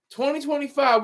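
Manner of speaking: ordinary talk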